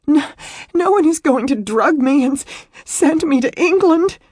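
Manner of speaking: high, loud, stammering wail; hysterical sobbing. A narrator's reading. Distraught woman explaining herself to him, hysterical. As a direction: blurt it between sobs.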